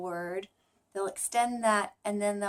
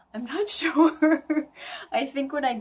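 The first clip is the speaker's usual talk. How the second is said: laughing; 5 semitones higher, 8 dB louder than usual. Real, not acted.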